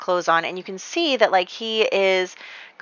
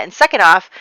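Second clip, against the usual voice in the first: ordinary talk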